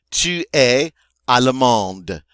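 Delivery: slow, loud "Tu es allemande."; French pronunciation. Shown as a demonstration. Teacher confirms students' answer, slow loud French model. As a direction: sound the final d of "allemande", slowly. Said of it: "did you say".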